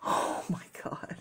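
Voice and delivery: whispered, slow oh my God; real stunned whisper; awed whisper. Real, not acted. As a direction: breathe it out in awe. Stunned by the card.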